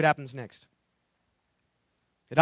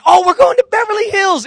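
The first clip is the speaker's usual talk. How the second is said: much higher and louder, giddy voice; excited hillbillies; 11 semitones higher, 10 dB louder than usual. Retold or imitated. Preacher voicing shepherds thrilled about Beverly Hills, excited. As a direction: play the giddy shepherds off to the big city. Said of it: "And they're going".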